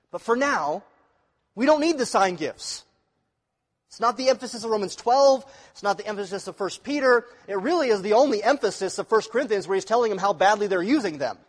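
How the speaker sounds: loud pulpit preaching; preaching with force. Acted. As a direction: press the point home to the congregation. Preaching loudly to congregation.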